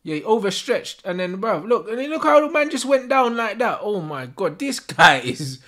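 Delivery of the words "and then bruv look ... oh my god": normal talking voice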